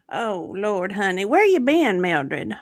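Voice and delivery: normal talking voice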